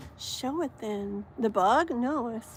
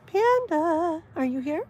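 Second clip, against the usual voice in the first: calling out; 7 semitones higher, 6 dB louder, slower than usual. Real, not acted. Calling out to a viewer.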